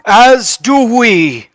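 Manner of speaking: a high, loud shout of defiance; in-character shout. Acted. Bazbeer's player shouts defiance at the gnolls, high and loud. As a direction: cut in sharply and loudly, high.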